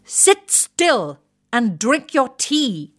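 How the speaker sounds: high, slow, barked order; retired officer bellowing at his wife from the balcony; bellowing wing commander. Acted. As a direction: bark it down at a cowering wife like an old officer. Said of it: "the wing commander bellows at his wife".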